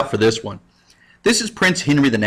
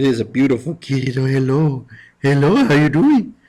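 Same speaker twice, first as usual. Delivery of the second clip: cat talk; 2 semitones higher than usual. Real, not acted. Teacher greeting the cat on screen, mild coo.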